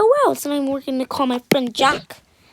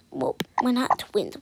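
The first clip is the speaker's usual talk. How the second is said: normal talking voice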